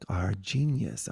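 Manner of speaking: ordinary delivery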